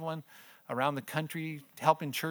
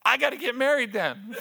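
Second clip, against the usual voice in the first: loud, high-pitched mock insistence; hammed-up daughter's wail; imitated teenage daughter; 11 semitones higher, 7 dB louder than usual. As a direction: blurt it high like an urgent teen. Daughter's urgent announcement, retold.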